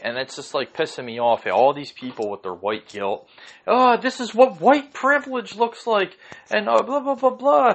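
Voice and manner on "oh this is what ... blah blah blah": high, loud sneering parody of preachy talk; mocking voice. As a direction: mimic them in a prissy, sanctimonious whine.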